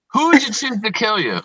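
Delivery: ordinary talk, nothing marked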